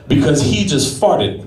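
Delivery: ordinary delivery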